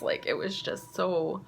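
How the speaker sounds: usual speaking voice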